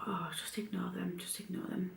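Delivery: softly telling herself to ignore it, near a whisper; hushed self-talk, barely voiced; quiet inner voice.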